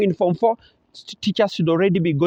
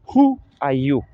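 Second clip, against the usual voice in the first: Who are you, stepping down to you; Who are you with a falling end; falling intonation; 3 semitones lower, slower than usual. Shown as a demonstration. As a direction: start on Who and fall to you. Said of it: "It's a falling intonation".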